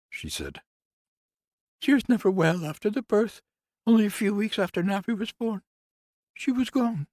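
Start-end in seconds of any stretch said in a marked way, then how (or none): 1.8-7.1 much higher, louder woman's voice, bitter; grieving nurse. Acted. Nurse complains to Conrad about the house, higher voice. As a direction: lift into the nurse's aggrieved voice.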